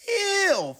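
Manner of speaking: high squeal of disgust. Acted.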